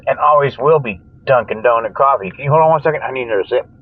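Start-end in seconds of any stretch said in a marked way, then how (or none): none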